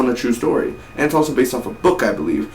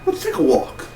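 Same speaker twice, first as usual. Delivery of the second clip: mock whisper. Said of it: "you go".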